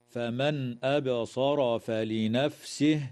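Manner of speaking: فمن أبصر with the nun pronounced fully, slow; clear nun. Shown as a demonstration. Teacher reciting slowly for students. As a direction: sound the nun clearly before the hamza. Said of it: "إظهار حلقي للنون الساكنة".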